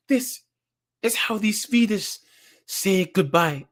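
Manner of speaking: slow the goodbye down, a hint of flirtation; Swedish woman's farewell. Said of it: "the Swedish chick kisses him and goes".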